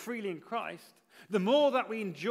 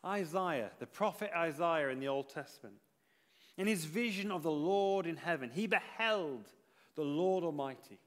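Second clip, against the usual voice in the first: ordinary talk, nothing marked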